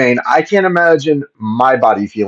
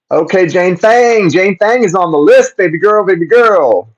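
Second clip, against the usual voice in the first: high, louder, beaming thanks to the donor; excited shout-out; 8 semitones higher, 3 dB louder than usual. Real, not acted. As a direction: hype up Jane Fang like she just made your day. Excited thank-you to a new donor.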